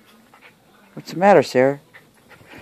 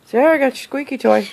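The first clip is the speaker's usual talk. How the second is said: high, louder sing-song to the dog; excited coaxing; 9 semitones higher, 6 dB louder than usual. Real, not acted. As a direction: coax the dog with the toy.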